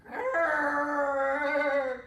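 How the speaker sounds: loud growling wolf. Acted. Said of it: "it may sound like one too".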